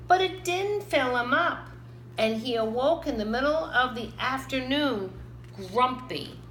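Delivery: usual speaking voice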